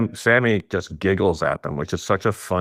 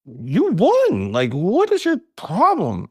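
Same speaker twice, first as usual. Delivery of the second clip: incredulous; 8 semitones higher, slower than usual. Retold or imitated. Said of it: "And I'm like".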